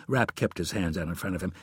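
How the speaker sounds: ordinary delivery, nothing marked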